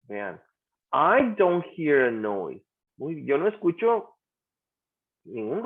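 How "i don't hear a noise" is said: slow teacher's demonstration; drawn-out example sentence for a class; slow model sentence. Shown as a demonstration. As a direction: say it slowly for learners.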